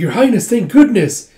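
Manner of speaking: high, loud exclamation of relief; excited relief. Acted. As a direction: exclaim on finding the princess. Rescuer finds princess in fire.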